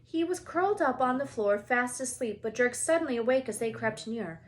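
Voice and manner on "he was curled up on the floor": usual speaking voice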